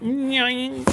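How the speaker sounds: high, springy little noise; imitated boing noise. Host shows how the caught thing went, imitated boing. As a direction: mimic something getting caught and springing loose. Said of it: "and it went".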